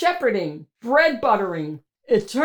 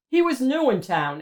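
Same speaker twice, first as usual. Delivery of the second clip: usual speaking voice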